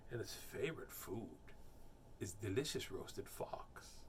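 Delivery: soft, low, dropping to a whisper; ominous storybook hush; hushed menace. Acted. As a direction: let the threat sink to a whisper. Mouse hints menacingly at fox's fate.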